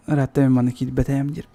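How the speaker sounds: ordinary talk, nothing marked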